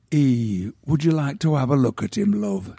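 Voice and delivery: broad northern English working-class voice, lightly done; a grieving widow from the north of England offering a look; gentle northern English accent, laughing through it. Retold or imitated. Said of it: "attempt to do the accent of my youth".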